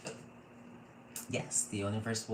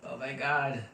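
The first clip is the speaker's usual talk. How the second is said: high, loud, delighted outburst; bursting with real joy at the signs; overjoyed; 8 semitones higher, 6 dB louder than usual. Real, not acted. Reader thrilled by matching signs, overjoyed high and loud. Joyful reaction to a reading.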